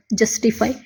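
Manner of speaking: Justify whispered to herself. Real, not acted. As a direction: whisper justify while writing. Teacher whispers word while writing. (फुसफुसाकर justify)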